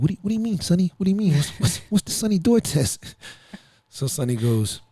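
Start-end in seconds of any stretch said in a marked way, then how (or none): 0.0-3.1 put-on high, eager kid's voice asking; puzzled teen asking his mentor; confused kid. Kid asking Sonny about the test, high and puzzled. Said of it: "he's like".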